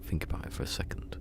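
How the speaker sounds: soft whisper of the phrase. Shown as a demonstration. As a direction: breathe it to yourself. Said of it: "and I was going".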